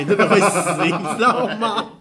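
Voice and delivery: loud, high and laughing; laughing exclaim. Real, not acted. To a friend, recalling the dodgeball kid, laughing loudly. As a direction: crack up while warning how deadly the throw was. Describing the big kid's dodgeball.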